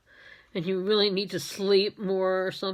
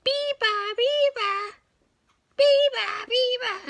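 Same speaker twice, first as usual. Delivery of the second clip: high chirpy bird call; high mimicked birdsong; bird call imitation; slower than usual. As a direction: sing it like a little bird. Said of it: "they sound like going".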